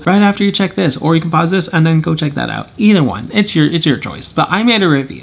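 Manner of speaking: ordinary talk, nothing marked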